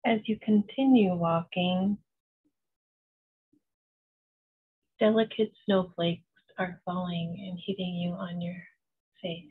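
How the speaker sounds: slow, even meditation voice with long pauses; unhurried, soothing visualization, letting each phrase settle; guided meditation. Acted. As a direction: guide them gently into calm, phrase by phrase.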